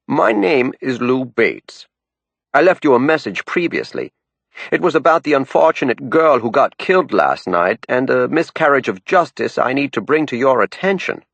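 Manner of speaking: put-on a caller with a thick down east Maine accent. A narrator's reading. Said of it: "accent was as thick as a down east fog bank".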